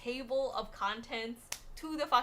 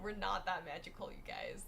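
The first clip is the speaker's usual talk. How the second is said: whispered aside; 4 semitones lower, 9 dB quieter than usual. Real, not acted.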